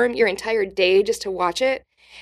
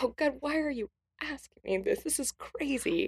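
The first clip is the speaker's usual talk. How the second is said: hushed disbelief; 4 semitones higher, 10 dB quieter than usual. Retold or imitated. Comedian's inner reaction to weird fan.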